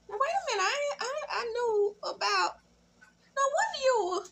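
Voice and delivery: high, giggly and flustered; flustered laughing. Retold or imitated. Imitating the caught wife, laughing and flustered.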